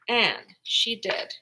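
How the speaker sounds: plain reading voice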